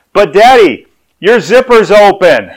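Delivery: high, loud, drawn-out announcement; loud child's voice. Retold or imitated. Daughter alerting Dad before the whole church, loudly. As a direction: imitate a child blurting it to everyone. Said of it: "in a loud voice, she goes".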